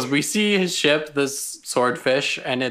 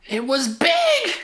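high, drawn-out holler; mock yell. Co-host joining the shouting bit, high drawn-out mock yell. Mock yelling over loud music.

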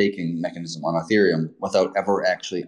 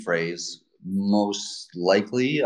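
deliberately slow, careful pace; slow it down so the listener can follow; slower; much slower than usual. Real, not acted. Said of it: "I'll try to talk slower".

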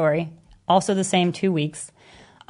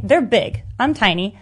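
ordinary talk, nothing marked

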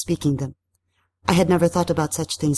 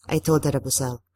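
usual speaking voice

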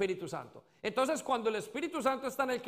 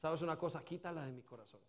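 hushed, low acted prayer; súplica en oración; 4 semitones lower, 8 dB quieter than usual. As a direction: let the plea sink down softly. Oración actuada al Espíritu Santo. (súplica actuada en voz baja)

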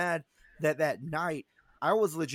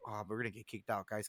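low, hushed mock worry; scared aside; 7 semitones lower, 10 dB quieter than usual. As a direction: warn the friends under your voice. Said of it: "I'm scared".

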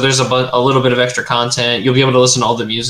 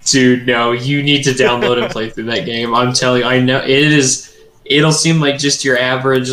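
normal talking voice

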